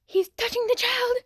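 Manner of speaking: high startled yelp of alarm; alarmed outcry. Real, not acted. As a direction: cry out in shock. Alarmed reaction to the video.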